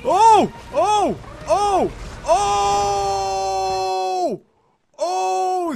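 long, high-pitched drawn-out "oh" cries; alarmed ohs. Real, not acted. Gamer reacting as things get real, alarmed high ohs. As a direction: wail a string of rising-alarm ohs. Boss fight suddenly starts.

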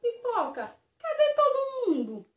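very high, loud startled question; surprised shout. Acted. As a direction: cry out in shock. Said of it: "Splash perguntou". (grito surpreso e agudo)

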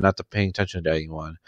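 plain reading voice

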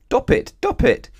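very high, loud yelp; blocked-trunk cry. A narrator's reading. Elephant with knotted trunk, crying out high and loud. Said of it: "cried the elephant, couldn't talk properly".

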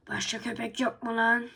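normal talking voice

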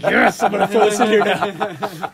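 loud, laughing gleeful line; laughing mock quote. Fan imitating the sound designer, loud and laughing. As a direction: belt it out through a laugh, delighted. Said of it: "I'm like".

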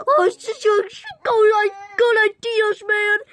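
high, loud gleeful cry; giddy loud self-praise; excited shout. Acted. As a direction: crow over the clever trap. Proud of planting traps.

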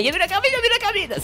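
very high, rapid mimicking voice; mocking spammers. Streamer mimicking viewers spamming him, mocking squeaky voice. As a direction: parrot pestering viewers in a squeaky rush. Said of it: "Tous ceux qui me spammaient".

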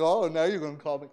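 fast, laughing dry quip; laughing irony. Retold or imitated. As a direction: tease them with a chuckle. Ironic laughing remark.

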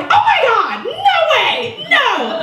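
high-pitched disbelief, hammed-up; mock horror. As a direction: squeal it in comic dismay. Retold horror at his age.

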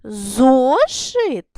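Зошит called out as a loud question, ЗО stretched; loud querying Зошит with drawn-out ЗО; questioning intonation. Shown as a demonstration. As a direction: ask Зошит loudly, leaning on the first syllable. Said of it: "з питальною інтонацією".

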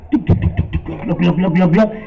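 low, soft tuc and gloc bubbling; bubbling water sounds. Acted. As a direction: imitate the pot starting to boil. Boiling water noise.

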